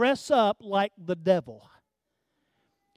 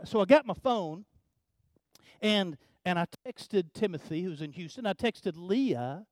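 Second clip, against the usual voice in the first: ordinary talk, nothing marked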